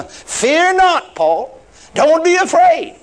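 high, ringing "fear not"; preacher driving home a reassurance; emphatic exhortation. Acted. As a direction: proclaim it with rising force. Repeats the quote with more force.

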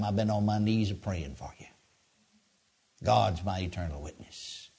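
soft, low and tender; kind voice. Retold or imitated. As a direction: speak gently, full of kindness. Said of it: "with kindness in my voice".

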